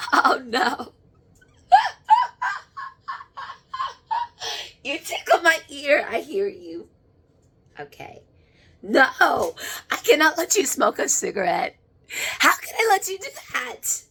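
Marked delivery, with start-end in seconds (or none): 1.7-4.8 very high, long squealing giggle; squealing laugh. Real, not acted. Medium tickled by a spirit, squealing with laughter. 12.4-14.0 much higher, squeaky protest. Real, not acted. Medium refusing to let a spirit smoke, protesting in a squeak.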